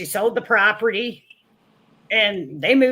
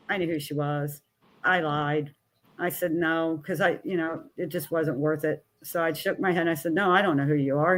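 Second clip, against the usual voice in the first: quiet lowered voice; 5 semitones lower, 6 dB quieter than usual. Retold or imitated. Mother lies quietly about knowing.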